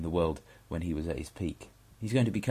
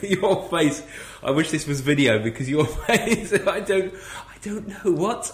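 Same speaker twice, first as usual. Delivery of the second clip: laughing; 9 semitones higher, 8 dB louder than usual. Real, not acted. Cracking up at partner's face.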